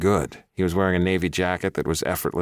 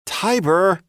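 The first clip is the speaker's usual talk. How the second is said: pleading; 5 dB louder than usual. A narrator's reading. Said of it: "Jeff pleaded".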